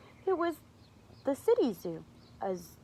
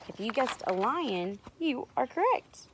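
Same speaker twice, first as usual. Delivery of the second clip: ordinary delivery, nothing marked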